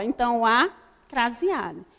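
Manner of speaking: ordinary delivery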